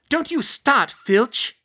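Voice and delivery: high, loud warning; spat angrily. A narrator's reading. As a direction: spit the warning out. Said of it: "she spat".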